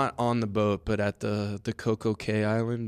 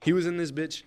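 reenacting tryhard kid; 5 semitones higher than usual. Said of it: "I'm about to do a reenactment".

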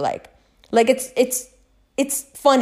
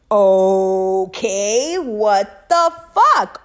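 slow, louder, stretched out; drawn-out disbelief; 3 dB louder, much slower than usual. Real, not acted. Telling viewers about the eerie calls, slow stunned disbelief. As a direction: let the words hang in amazement. Reacting to three same-day calls.

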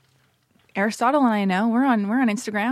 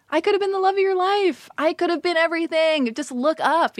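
mock longing plea; 7 semitones higher than usual. Imagined plea to passing men.

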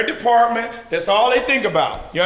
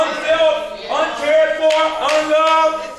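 pitched-up preaching, swelling to a shout on the last line; rising preaching; 7 semitones higher than usual. Acted. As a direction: preach it, climbing toward the cry. Preacher building to climax.

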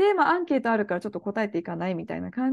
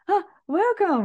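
imitating an overly friendly greeter, pitched up; a mock-cheerful hello, a touch higher; friendly welcome; 8 semitones higher, much slower than usual. American recruiter greeting the girls, mock friendly welcome. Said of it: "すごいフレンドリーに".

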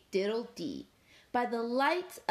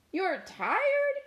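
high, louder outraged squeal; indignant Cow; 11 semitones higher, 4 dB louder than usual. Acted. Cow snapping at sleepy Dog, high and indignant. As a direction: yelp it back in disbelief. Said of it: "said Cow".

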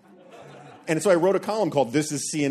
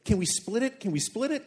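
high, pleading panic; imitating a panicky rider; panicked; 6 semitones higher than usual. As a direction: beg in a panic, pitched up. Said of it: "in, like, a panic and says".

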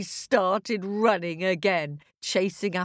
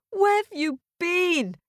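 worried dad calling; 12 semitones higher than usual. A narrator's reading. Dad's voice in story.

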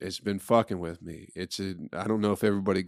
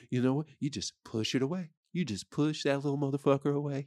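mock instructor; 6 semitones higher than usual. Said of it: "They go".

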